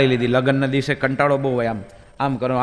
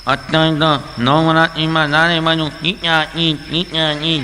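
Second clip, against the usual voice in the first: nasal mumble; slower than usual. Retold or imitated. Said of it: "એ લોકો નાકમાંથી બોલે".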